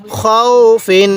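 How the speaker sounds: খৌ held as a long glide, then ফিন; drawn-out recitation. Shown as a demonstration. Teacher showing how to read it, খৌ drawn out. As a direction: stretch খৌ slowly before ফিন. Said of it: "কীভাবে পড়তে হবে?".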